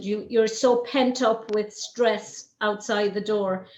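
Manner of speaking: normal talking voice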